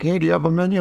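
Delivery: high, naive customer asking. Retold or imitated. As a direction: mimic the guy asking. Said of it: "He's like".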